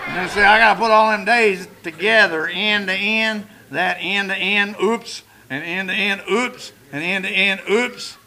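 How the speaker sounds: comic demo of piling up clean days and slipping; mock stumbling. Shown as a demonstration. To the room, joking about relapse, playful oops each time. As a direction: lay the days end to end, then trip with each oops. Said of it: "That's what we call chronic relapse".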